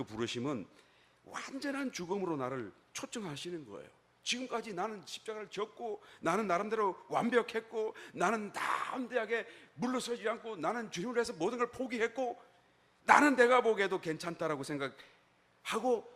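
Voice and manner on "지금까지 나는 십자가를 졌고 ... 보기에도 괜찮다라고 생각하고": high, forceful listing of one's merits; 자부하는 항변. Acted. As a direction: insist on your record, pitch held high. 자부하는 신자의 말을 재연. (높은 음으로 힘주어 내세우듯)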